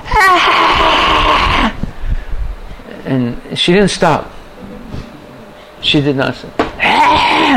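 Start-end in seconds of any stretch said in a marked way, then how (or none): 0.1-1.7 long, high mock scream; imitated scream. Imitating the advocate screaming in class, high mock scream. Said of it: "advocate started screaming". 6.6-7.6 high-pitched mock scream; imitated scream. She did not stop, imitated high scream. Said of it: "she was, like, screaming".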